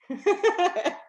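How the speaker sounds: short high laugh at herself. Real, not acted. Telling her audience about the fight, a rueful laugh. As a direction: laugh off the memory.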